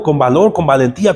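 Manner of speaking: normal talking voice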